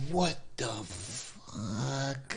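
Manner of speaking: very slow, hushed, stretched-out astonishment; stunned disbelief. Retold or imitated. Boxer finding his eyeball on the canvas, slow stunned disbelief. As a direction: draw each word out softly, dumbfounded. Retold shock at being knocked down.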